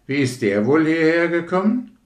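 hoch und laut gerufen; lauter Jungenruf. Acted. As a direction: ruf es laut hinüber, staunend. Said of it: "schrie einer der Jungen". (Junge ruft dem anderen laut zu)

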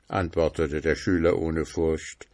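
ordinary delivery, nothing marked

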